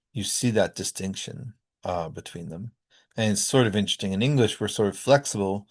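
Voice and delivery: ordinary talk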